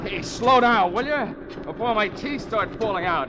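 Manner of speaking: rattled, pleading holler from a jolting Jeep; jolted shout. Acted. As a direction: shout at the driver while bouncing around. Bouncing in a speeding Jeep.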